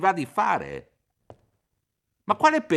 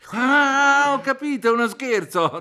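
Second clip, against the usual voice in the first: higher, louder aha; sudden realisation; 9 semitones higher, 5 dB louder than usual. Acted. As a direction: catch on with relief. Realises it must be a prank.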